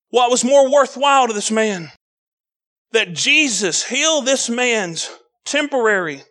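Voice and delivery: ordinary talk, nothing marked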